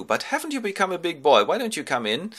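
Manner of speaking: high, cooing welcome; fussing host. Acted. Menelaus welcomes the son in, high fussing voice. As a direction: coo over the visiting lad. King fusses over the boy.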